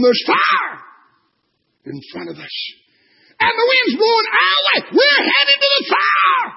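high, loud warning cry; urgent shout. Acted. Scout shouting a fire warning to the captain. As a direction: yell the alarm to the captain.

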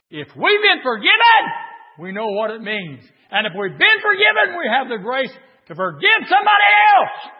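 sudden loud, high preaching burst; full force preaching. Acted. Preacher urges the church to forgive, shouting. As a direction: break out in fervent shouting.

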